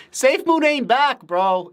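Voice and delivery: mock them through a laugh. Real, not acted. Streamer answering chat about SafeMoon, laughing.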